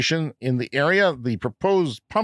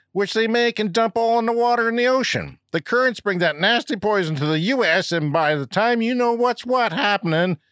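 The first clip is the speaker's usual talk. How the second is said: mock hillbilly American accent, pitched higher; 6 semitones higher than usual. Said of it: "read this in your best dumb redneck American accent".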